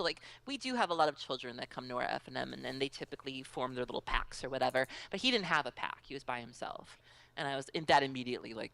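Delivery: usual speaking voice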